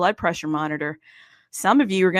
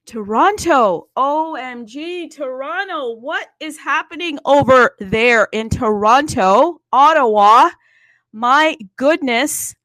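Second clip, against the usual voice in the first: loud, higher, gushing city shout-outs; excited shout-out; 5 semitones higher, 9 dB louder than usual. Real, not acted. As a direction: holler out to Toronto and Ottawa like a thrilled host. Shouting greetings to Canadian cities.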